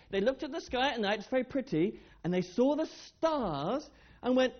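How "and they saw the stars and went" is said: ordinary talk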